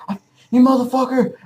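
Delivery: high, fast acted-out curse; hammed-up attacker's rage; mock angry outburst. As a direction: spit out a quick high-pitched insult. Beast man attacks dreamer.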